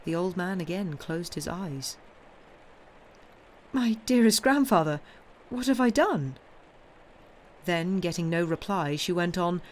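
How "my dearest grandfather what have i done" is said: high, raised-voice appeal; dismayed granddaughter; distressed pleading. A narrator's reading. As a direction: plead to know your fault.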